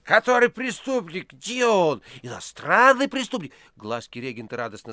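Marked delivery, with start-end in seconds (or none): 0.0-3.5 high, loud theatrical excitement; mock-eager yell. A narrator's reading. Precentor pretending to help catch the criminal, loud and high. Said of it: "вскочил и заорал".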